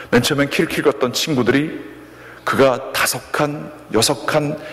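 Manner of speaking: usual speaking voice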